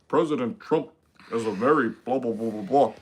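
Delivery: low, measured statesman impression; Benjamin Netanyahu. Impressionist asked for Netanyahu, low trailing blahs. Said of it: "do Benjamin Netanyahu".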